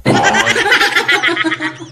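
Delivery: a burst of high giggling; light giggle; high laugh. Real, not acted. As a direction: giggle at the memory. Laughing at own story.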